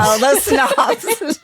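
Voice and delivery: a hint of slow, high mock snobbery, laughing; mock snooty. Guest mocks Jersey City snobs in a slow, laughing put-on voice. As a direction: drawl it like a snob, laughing.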